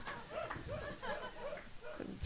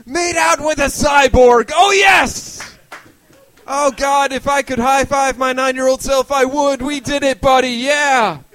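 loud, high, gleeful shouting; triumphant inner voice; 6 semitones higher, 8 dB louder than usual. Acted. Comic's brain celebrating a hookup with a cyborg, triumphant shouting. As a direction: whoop it out like a kid who just won big. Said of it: "another part of my brain was just saying".

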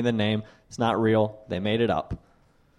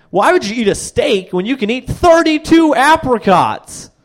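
high, loud preachy vegan pitch; mock vegan pamphlet; 10 semitones higher, 6 dB louder than usual. Comic mocking his vegan friend's pamphlet with a loud preachy voice. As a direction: sell the apricots like a zealot.

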